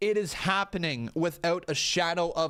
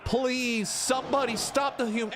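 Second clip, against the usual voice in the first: pleading shout; 8 semitones higher than usual. Real, not acted. Begging while watching the clip.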